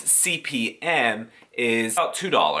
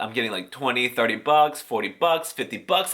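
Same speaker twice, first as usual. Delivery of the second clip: ordinary delivery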